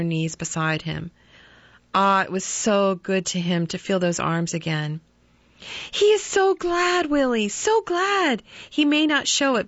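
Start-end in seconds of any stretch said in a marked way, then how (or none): 5.9-9.7 much higher, glad and eager; mother reassuring her son; joyful mother. Acted. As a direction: make him believe his father is glad.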